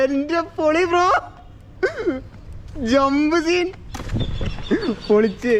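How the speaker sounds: high, thrilled hype; excited cheering. Real, not acted. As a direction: cheer the jump on. Cheering skater's jump.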